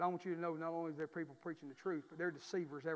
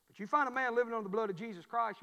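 ordinary delivery

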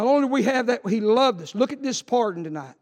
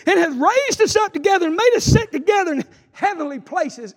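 loud, high scripture reading; proclaimed scripture; 9 semitones higher, 7 dB louder than usual. Acted. Preacher reading Ephesians to the church, loud and high. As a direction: thunder out the verse.

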